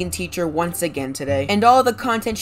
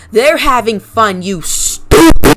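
angry shout; 3 semitones higher, 13 dB louder, slower than usual. Acted. Yelling at vegan teacher.

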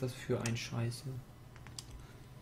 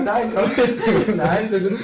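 laughing; 8 semitones higher, 14 dB louder than usual. Real, not acted. Laughing through words.

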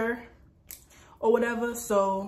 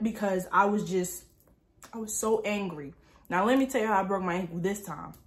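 ordinary talk

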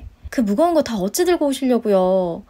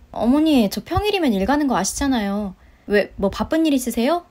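ordinary delivery

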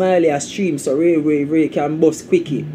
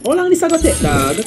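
pitched up high, exasperated; impatient whine; 7 semitones higher than usual. Real, not acted. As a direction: stretch it up like you've waited forever. Impatient complaint.

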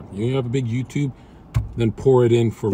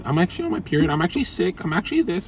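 high-pitched mock woman making excuses; udawanie kobiecych wymówek; 7 semitones higher, faster than usual. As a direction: put on a higher voice for whiny excuse-making. Mimics women making excuses for work.

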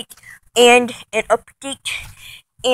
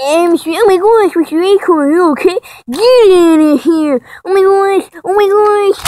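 loud, higher, hammed-up panic; mock gamer shouting; 5 semitones higher, 9 dB louder than usual. Gamer reacting to Mister Acorn, yelling in mock alarm. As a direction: shout as if the game went wrong. Parody of frantic gaming commentary.

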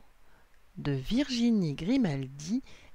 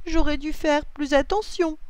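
whining; 9 semitones higher, 3 dB louder than usual. A narrator's reading. Lily blames herself for losing hat, whining. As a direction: fret aloud, pitched up. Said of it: "gémit Lily".

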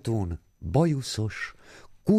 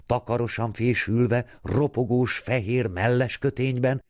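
usual speaking voice

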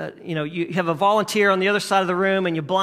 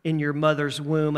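low, soft, tender reassurance; gentle whisperer; 6 semitones lower, 5 dB quieter than usual. Acted. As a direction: speak softly and low, as God reassuring. Voicing God's gentle whisper.

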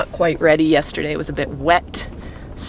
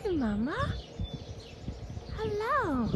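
much higher and much softer cooing; soft pet talk; 14 dB quieter, slower than usual. Real, not acted. Farmer softly greeting a mother cow, high cooing. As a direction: croon a gentle hello to the cow.

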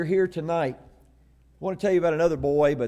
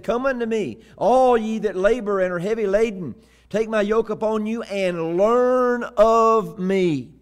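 higher, louder, stretched-out invitation; preacher declaiming scripture; 6 semitones higher, 5 dB louder than usual. Acted. Preacher quoting Jesus's call to the weary, declaiming. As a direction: build to a long, ringing last phrase.

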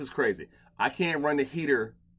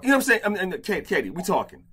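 normal talking voice

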